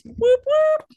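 high, loud cheering whoops; celebratory whoop. Real, not acted. Celebrating top ten percent ranking.

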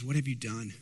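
low, soft dismay from the prophet; a quiet, shocked question; hushed rebuke. Acted. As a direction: say it quietly, stunned.

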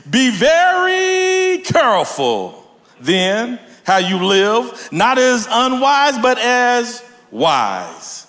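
high, stretched-out preacher's reading; emphatic scripture reading. Acted. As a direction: draw out the key words slowly. Reads scripture aloud.

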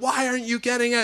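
mock yell from the bench; retold coach's yell. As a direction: push the pitch up like a yelling coach. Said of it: "He's yelling and yelling".

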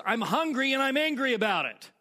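loud, high mock-angry declaration; mad cousin. Cousin explaining to baffled relative, mock furious. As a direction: act out a hungry cousin's outburst. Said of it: "She's getting mad at me".

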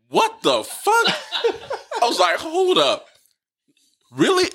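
high, laughing disbelief; reliving the shock with a laugh. Retold or imitated. Friend retelling hearing the news, laughing disbelief.

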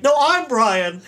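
high mock protest edged with laughter; rival claimant. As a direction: imitate someone insisting over the others. Another man makes the same claim.

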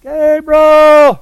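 long, high call from far away; distant calling. Retold or imitated. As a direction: call his name across the snowy woods. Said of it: "I perked up".

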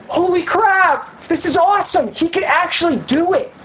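high-pitched dawning amazement; amazed excitement. Retold or imitated. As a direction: act out someone thrilled and newly convinced. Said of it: "getting this, like, excitement inside of him".

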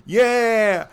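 high, loud peppy cheer; cheery encouragement. Retold or imitated. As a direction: pump them up brightly. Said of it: "where she's like".

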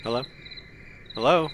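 ordinary delivery

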